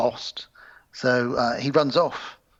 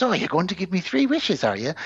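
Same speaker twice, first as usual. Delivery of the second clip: higher, mocking sing-song question; mock-impressed needling; taking the piss; 6 semitones higher than usual. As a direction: tease him in a put-on higher voice. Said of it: "takes the piss out of him".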